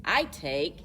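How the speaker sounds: ordinary delivery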